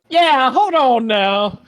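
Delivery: put-on slow, drawling Southern accent. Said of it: "why are you doing a Southern accent?".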